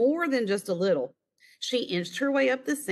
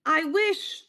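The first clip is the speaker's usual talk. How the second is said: put-on higher, hopeful child voice; girl making a wish; 9 semitones higher than usual. A narrator's reading. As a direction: start a wish like a little girl. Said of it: "She closed her eyes and began".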